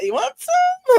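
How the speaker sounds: very high, loud squeaky imitation of a girl offering; mock offer. Younger sister offering Kenny a smoke, high mock offer. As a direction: pitch it way up, playfully imitating an offer. Acting out Julie offering weed.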